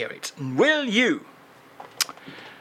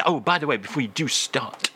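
usual speaking voice

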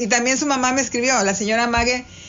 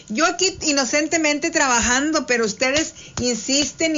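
ordinary delivery

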